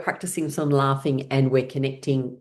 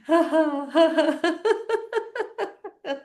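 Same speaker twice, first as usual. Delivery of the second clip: breathy falling laugh. Real, not acted. Laughter game.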